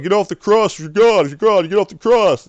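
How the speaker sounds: loud high jeering shout; crowd yelling. Retold or imitated. Mocking crowd shouts at Jesus on the cross, loud jeer.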